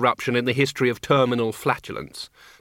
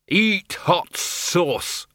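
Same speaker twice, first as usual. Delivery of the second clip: high, very drawn-out war cry; roared battle cry; 6 semitones higher, much slower than usual. A narrator's reading. Archchancellor taunts the exploded heap, drawn-out mock roar. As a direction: stretch each word like an action-hero taunt. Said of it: "roared the Arch-Chancellor".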